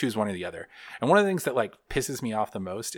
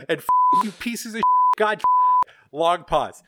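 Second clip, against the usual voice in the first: mock rage; 10 semitones higher, 6 dB louder than usual. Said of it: "Just like".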